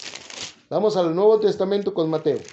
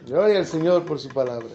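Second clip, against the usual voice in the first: ordinary talk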